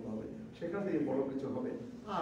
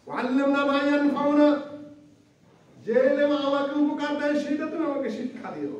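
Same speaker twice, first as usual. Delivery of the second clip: higher, louder pleading prayer; fervent prayer; 11 semitones higher, 7 dB louder than usual. Acted. Preacher pleading to God for useful knowledge, fervent. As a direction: cry out the dua to God. Prays dua with translation.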